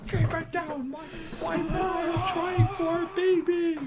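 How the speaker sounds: high-pitched complaining neighbour voice; high annoyed neighbour. Pretend. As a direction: whine it up high like a fed-up neighbour. Mock neighbour scolding the couple.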